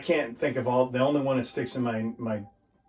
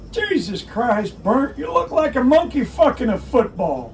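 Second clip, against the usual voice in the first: loud, high barking drill sergeant; drill sergeant yelling; 13 semitones higher, 9 dB louder than usual. Retold or imitated. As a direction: bark it like an angry drill sergeant. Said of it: "Like my old drill sergeant used to say".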